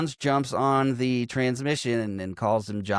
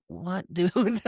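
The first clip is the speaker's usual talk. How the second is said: baffled reaction; 6 semitones higher than usual. Retold or imitated. Incredulous reaction to film scene.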